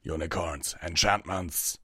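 a restrained battle call. A narrator's reading. Vanguard orders his unicorns in battle, calling out. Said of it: "Vanguard called out".

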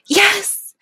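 high, loud yes; high-pitched loud outburst; eager cry. Acted. Character cries out assent.

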